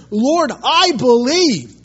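loud, high, drawn-out cry of faith; acted tearful cry-out. Weeping father crying out to Jesus, acted loud desperate plea. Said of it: "he cries out with tears".

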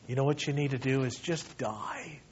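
soft, low imitation of an elder's rebuke; Pastor Chuck, understated. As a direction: deliver it quietly and matter-of-factly. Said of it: "Pastor Chuck will just say".

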